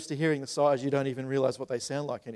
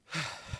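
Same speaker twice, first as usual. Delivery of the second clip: audible sigh; 9 dB quieter than usual. Acted. Preacher shows the congregation how Jesus sighed, soft sigh. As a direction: let out one slow tired breath. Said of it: "he sighed".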